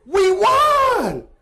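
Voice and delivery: loud, high shout; teammates shouting. Retold or imitated. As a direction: yell it in joyful confusion. Teammates shouting at crying boy.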